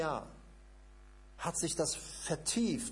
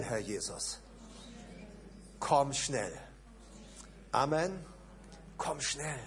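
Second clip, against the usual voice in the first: led prayer. Acted. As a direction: lead the congregation in longing prayer. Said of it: "Lass uns das doch mal zusammen so sagen".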